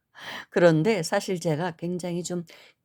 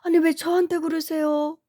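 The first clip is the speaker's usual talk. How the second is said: startled protest; 10 semitones higher than usual. Retold or imitated. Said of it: "깜짝 놀라가지고".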